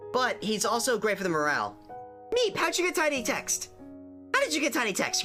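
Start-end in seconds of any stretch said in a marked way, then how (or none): 2.4-5.2 high squeaky voice. Real, not acted. Reacting to chat message.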